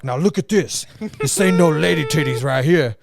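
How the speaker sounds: loud, high hammed-up coaching voice; mock gym guy demo. Storyteller acting the gym guy coaching them, loud mock coach voice. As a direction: play the stranger demonstrating, loudly. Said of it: "he's like".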